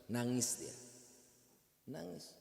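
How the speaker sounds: ordinary talk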